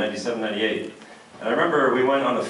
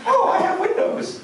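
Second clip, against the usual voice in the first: very high squeaky mock voice; mocking Windows user. Comedian mocking an audience member with a Windows phone, squeaky high. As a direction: mimic a clueless phone owner.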